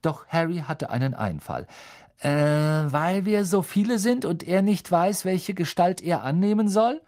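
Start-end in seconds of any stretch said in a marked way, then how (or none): 2.2-7.0 higher, louder eager pupil voice; eager schoolgirl. A narrator's reading. Hermine eagerly answering the teacher, higher and louder. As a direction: answer like a know-it-all pupil.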